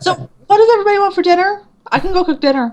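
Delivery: high, quick, breezy dodge; change the subject brightly; mock-innocent chirp; evasive change of subject. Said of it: "I'm like".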